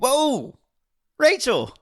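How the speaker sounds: sudden high startled yelp; startled surprise. Real, not acted. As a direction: caught off guard, yelp her name. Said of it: "Kim, that was jarring".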